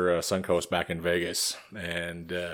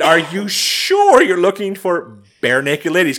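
retold disbelief; 9 semitones higher, 10 dB louder than usual. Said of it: "I said".

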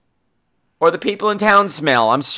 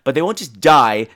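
normal talking voice

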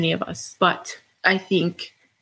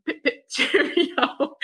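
slow, laughing mock British accent; pip-pip-cheerio posh British, hammed up with a laugh; 8 semitones higher, slower than usual. Hosting alone, playing with her British accent, slow and laughing. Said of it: "fun to speak with a British accent".

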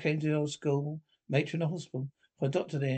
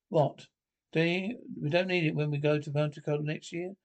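ordinary talk, nothing marked

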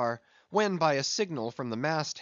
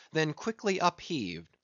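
plain reading voice

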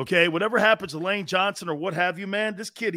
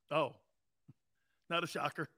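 ordinary delivery

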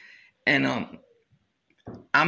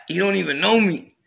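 normal talking voice

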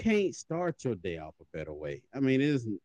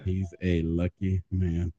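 "He's a lucky man" drawn out word by word; slow right down on "He's a lucky man"; half-speed emphasis on every word of "He's a lucky man"; slow, emphatic; 2 semitones lower, much slower than usual. Real, not acted. Repeats it slowly for emphasis.

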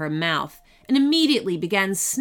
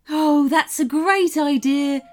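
gentle unicorn voice; 7 semitones higher, 6 dB louder than usual. A narrator's reading. Unicorn agreeing eagerly.

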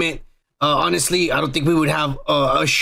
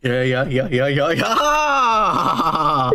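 stretched yeahs; much slower than usual. Pretend.

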